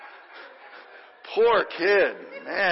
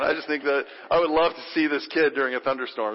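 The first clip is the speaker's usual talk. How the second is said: ordinary talk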